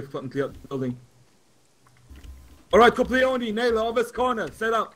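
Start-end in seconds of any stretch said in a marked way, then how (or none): 2.7-4.9 sharp, loud raised orders; barking positions to the squad; combat callout. Real, not acted. Squad leader assigning positions.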